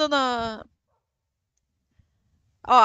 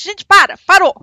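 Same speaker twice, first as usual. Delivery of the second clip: loud, exasperated "stop!"; shouting; 4 semitones higher, 10 dB louder than usual. Real, not acted. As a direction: cut the chat off with a shout. Chat confusing her, she cuts in. (gritando exasperada)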